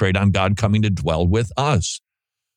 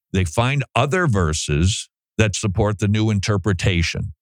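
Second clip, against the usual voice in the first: ordinary delivery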